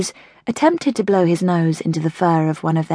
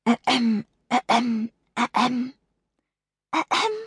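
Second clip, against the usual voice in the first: soft higher ahems, last one rising; repeated coughing; 5 semitones higher, 5 dB quieter than usual. Acted. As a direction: keep clearing the throat for attention. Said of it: "coughed Holly".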